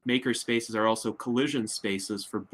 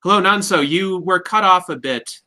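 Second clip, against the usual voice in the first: louder and higher, calling over the line; 8 semitones higher, 8 dB louder than usual. Real, not acted. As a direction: raise the voice to reach someone who dropped out. Calling out after a dropped call.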